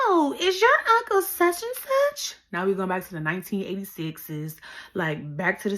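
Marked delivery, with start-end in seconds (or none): 0.1-2.3 very high, loud excited mom squeal. Retold or imitated. Mom recognizing boyfriend's uncle, giddy squeal. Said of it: "can y'all guess what she said".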